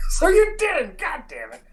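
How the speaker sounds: high triumphant shout then grumble; gleeful outburst. Real, not acted. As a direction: crow it, caught you. DM catches player bluffing.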